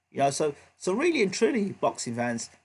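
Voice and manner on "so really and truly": ordinary talk, nothing marked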